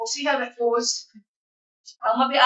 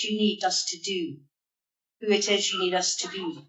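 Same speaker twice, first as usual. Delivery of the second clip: prayer; 4 semitones lower than usual. Acted. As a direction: lead the church in prayer. Leads a congregation prayer.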